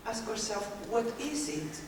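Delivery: normal talking voice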